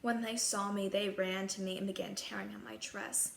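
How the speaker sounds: ordinary delivery, nothing marked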